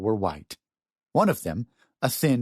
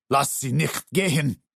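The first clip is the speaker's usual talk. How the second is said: raised, curt command in German; barked order; 5 semitones higher than usual. A narrator's reading. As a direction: bark it like a soldier giving orders. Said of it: "turned to the thin man and barked".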